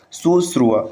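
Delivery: ordinary delivery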